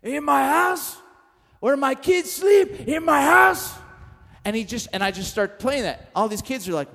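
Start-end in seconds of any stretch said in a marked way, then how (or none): 0.0-3.7 building from a tense question to a high, loud furious scream; a mob boss roaring at betrayal in his home; In my house, louder each time; screaming Godfather rage. Retold or imitated. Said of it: "he starts screaming".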